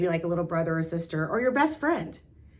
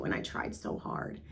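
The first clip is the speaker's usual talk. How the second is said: defeated whisper; 12 semitones lower, 10 dB quieter than usual. Retold or imitated. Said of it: "maybe it's".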